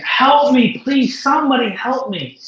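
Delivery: high, loud plea to passersby; retold cry for help. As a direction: call out pleadingly, pitch lifted. Retold plea of crippled woman.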